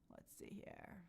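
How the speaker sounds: whispered Let's see here; soft whispered aside to herself. Pretend. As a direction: breathe it out as a whisper. Scrolling chat quietly.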